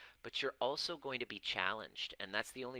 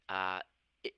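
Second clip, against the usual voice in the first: ordinary talk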